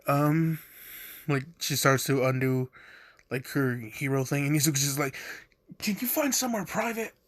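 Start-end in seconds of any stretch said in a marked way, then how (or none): 5.8-7.1 high, fast quote. Retold or imitated. Izuku's quote, pitched high.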